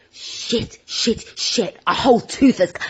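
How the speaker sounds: half-whispered retold alarm; retold panic. Host reliving a tooth scare in mock panic. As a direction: hiss the swearing under your breath, then voice the alarm.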